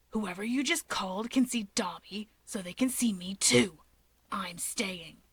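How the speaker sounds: hushed, breathy worried whisper; whispered Shigaraki. A narrator's reading. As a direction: whisper it, anxious and clingy.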